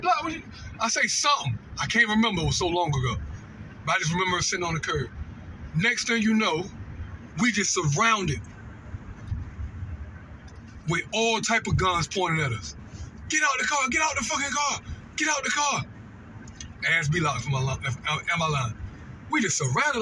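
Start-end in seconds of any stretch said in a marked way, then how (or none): 0.0-0.4 high call out the car window. Retold or imitated. Calling to his cousin out the car window, raised. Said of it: "I say something out the window". 13.3-15.8 high barked orders to get out; mock police shout. Police shouting at them in the car, hammed-up yell.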